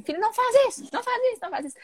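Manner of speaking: put-on high, repeated scolding of a child; nagging parent. Mother scolds her son drawing on the wall, high nagging voice. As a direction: play the mother saying no again and again. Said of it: "Ao invés de você falar assim".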